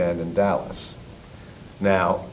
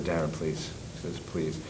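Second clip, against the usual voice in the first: quiet pleading; 5 semitones lower, 14 dB quieter than usual. Retold or imitated. Manager pleading with Darren.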